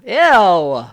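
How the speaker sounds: loud, grossed-out "ew"; disgusted. Real, not acted. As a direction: recoil in real disgust. Disgusted reaction to money grab.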